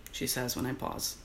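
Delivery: usual speaking voice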